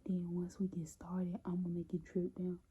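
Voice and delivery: hushed whisper so nobody hears; whisper, hiding in the next room. Real, not acted. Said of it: "I'm whispering right now because she is right next to my room".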